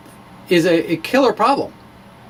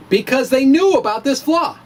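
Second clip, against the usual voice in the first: words breaking into a higher laugh; 8 semitones higher than usual. Real, not acted. Admitting the experts dismissed his idea, laughing.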